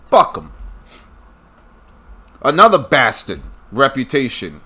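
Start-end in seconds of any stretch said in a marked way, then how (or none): 0.1-0.5 louder, higher second fuck him; angry. Real, not acted. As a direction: spit it out harder the second time. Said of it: "makes me say".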